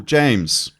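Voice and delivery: usual speaking voice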